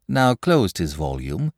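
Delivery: ordinary delivery, nothing marked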